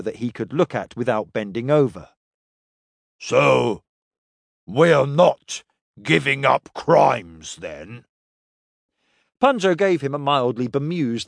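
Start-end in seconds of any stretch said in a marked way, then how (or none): 3.2-8.1 slow, halting alien character voice; slow-witted alien crewman. Acted. Kref asks Punjo about the crew's future, slow and puzzled. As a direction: puzzle it out in heavy separate chunks.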